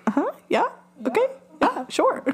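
high, airy brush-off agreeing to everything; dismissive uh-huh. Retold or imitated. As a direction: humor them with fake agreement. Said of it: "Aunt Lori's sitting there like".

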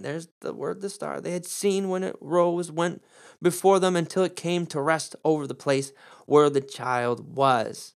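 ordinary delivery

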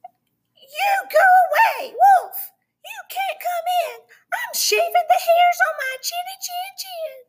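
high squeaky yell; yelling pig voice. A narrator's reading. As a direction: shout it as a tiny pig. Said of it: "Mister Pig yelled back".

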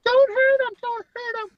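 high mock plea of a worried friend; imitating Tanya. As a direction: squeak it out as the panicking girlfriend. Tanya pleading in retold scuffle.

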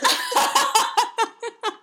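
shrieking laugh; helpless high cackle; loud high laughter. Real, not acted. Breaks up reading.

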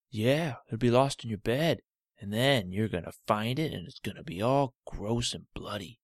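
a cranky toe refusing the Band-Aid; stubborn talking toe. Acted. Cut toe protesting to Olivia, loud and grumpy.

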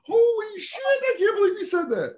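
high, shrieking mock delight through laughter; shocked laughing crowd. Comic imitates fans shrieking with laughter at the joke. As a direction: crack up in disbelief. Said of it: "half the crowd's like".

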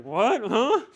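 jolted awake and baffled; wife woken at midnight, surprised; startled wife. Acted.

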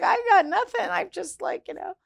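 high-pitched, squeaky "I'm done for" voice; mock-helpless, voice shooting up high; retold helpless panic. Facing [name]'s attorneys with nothing, high helpless mock panic.

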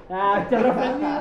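high, loud, slow huffy tone; hammed-up indignation; mock offended. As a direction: play up wounded pride. Mocking offended reaction.

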